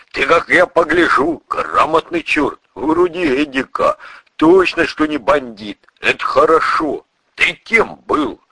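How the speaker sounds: high, lively, approving chatter; pleased burly priest. Acted. Priest decides the narrator is no bandit, hearty and approving. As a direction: size him up with hearty approval.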